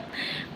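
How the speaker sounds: short soft chuckle; a brief, quiet laugh to yourself. Real, not acted. Laughs while teasing a viewer.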